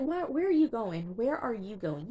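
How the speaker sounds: high-pitched incredulous questioning; acted-out baffled outcry; retold disbelief. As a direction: question it in mock alarm, pitch jumping up. Retold incredulous reaction to patient leaving.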